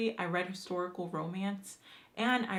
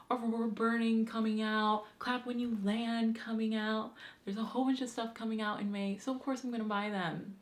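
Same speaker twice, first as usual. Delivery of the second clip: ordinary talk, nothing marked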